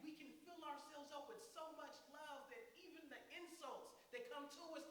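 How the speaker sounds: usual speaking voice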